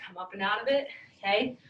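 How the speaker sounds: ordinary delivery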